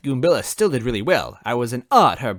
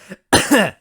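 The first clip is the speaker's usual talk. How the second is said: loud, rough coughing; smoky cough; 10 semitones higher, 8 dB louder than usual. Acted. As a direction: cough out smoke. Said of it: "Goombella coughed".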